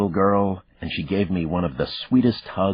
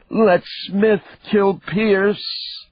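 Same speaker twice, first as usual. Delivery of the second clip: very high, slow, drawn-out screech; a madman shrieking his own death wish; 5 dB louder, much slower than usual. A narrator's reading. Deranged killer screeching at the detective, wild shriek. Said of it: "he screeched".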